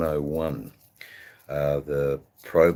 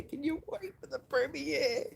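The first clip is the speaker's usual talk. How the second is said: squeaky falsetto tease; 7 dB quieter than usual. Pretend. Teasing viewers about the premiere.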